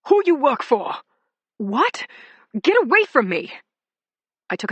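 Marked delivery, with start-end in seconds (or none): 0.1-1.0 put-on loud, high accented shout. A narrator's reading. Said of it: "There was another shout". 1.6-3.5 loud, high, frightened cry; panicked shout. A narrator's reading. Panicked under attack.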